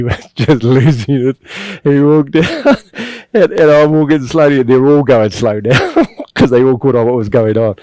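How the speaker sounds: can barely get it out for laughing. Real, not acted. Laughing while retelling the prank.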